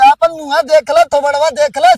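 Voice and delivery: loud, heated jeer; angry taunt. Acted. Quarrelling partner insulting the other's face, loud and scornful.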